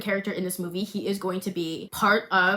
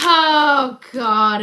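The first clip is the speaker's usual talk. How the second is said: emotional outburst; 3 semitones higher, 11 dB louder than usual. Real, not acted. Overcome reacting to her scene.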